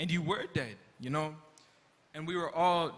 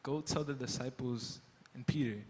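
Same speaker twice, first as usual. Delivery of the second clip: softer, lower, dawning on him; hushed realization; 4 semitones lower, 5 dB quieter than usual. Acted. Peter realizing the angel named him, hushed. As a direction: let it sink in quietly. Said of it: "The angel said what?".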